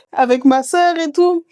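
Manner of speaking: put-on high, slow childlike Mahoran accent. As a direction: imitate the child's accent from Mayotte, drawn out. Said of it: "Avec l'accent". (voix aiguë, accent mahorais)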